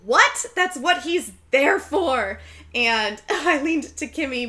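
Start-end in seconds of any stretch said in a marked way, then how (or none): 0.0-2.4 incredulous friend. Retold or imitated. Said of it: "And the girlfriend is like".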